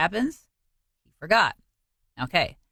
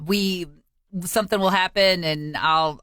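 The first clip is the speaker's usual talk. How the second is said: usual speaking voice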